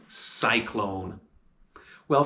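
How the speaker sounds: ordinary delivery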